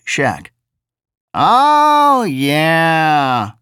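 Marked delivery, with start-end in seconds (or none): 1.3-3.5 high, drawling simple-minded voice; drawly jejune voice. Acted. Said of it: "the driver said".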